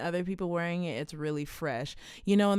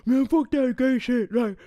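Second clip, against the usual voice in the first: higher, louder macho voice; tough guy voice; 8 semitones higher, 9 dB louder than usual. Retold or imitated. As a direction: ham up a macho homophobe.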